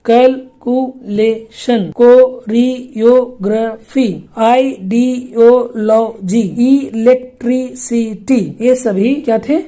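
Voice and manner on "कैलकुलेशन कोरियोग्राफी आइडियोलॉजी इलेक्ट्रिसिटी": broken into syllables. Shown as a demonstration. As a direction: spell each word out in slow syllable chunks like a teacher. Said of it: "इनके खंडन के साथ syllable का number". (शब्दों को धीरे-धीरे syllable में तोड़कर बोलना)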